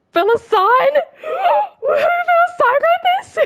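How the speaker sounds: can't believe who wrote it; surprised. Real, not acted. Surprised to find the author.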